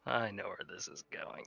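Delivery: hushed knowing aside; amused whispered aside. Real, not acted. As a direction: murmur it with amusement.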